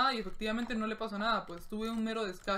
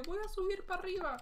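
a higher, lightly put-on voice; mock self-quote; 9 semitones higher, faster than usual. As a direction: quote yourself with a goofy lilt. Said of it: "No sé si está bien expresado eso".

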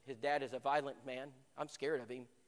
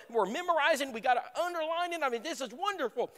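higher, bright with zeal; eager excitement; 9 semitones higher, 4 dB louder than usual. Retold or imitated. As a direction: cheer the hard work on. Thrilled Bible study prep.

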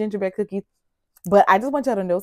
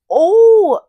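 delighted; 12 semitones higher, 12 dB louder than usual. Real, not acted. Sudden delighted exclamation.

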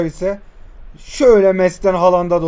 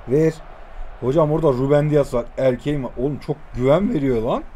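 normal talking voice

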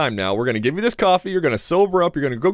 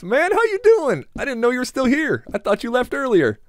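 high fake friendly voice; 10 semitones higher than usual. Greeting Patrick in fake voice.

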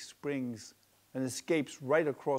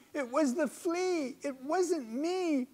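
high, drawn out desperate bug plea; helpless screeching; 14 semitones higher, 4 dB louder, slower than usual. A narrator's reading. Bedbug pleading innocence from the maid's fingers, screeching. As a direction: screech again, more desperate. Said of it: "He screeches this out helplessly".

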